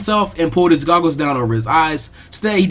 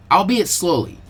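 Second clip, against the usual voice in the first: usual speaking voice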